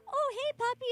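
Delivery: put-on high, loud baby-talk to a puppy; dog voice. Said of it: "like, the dog voice".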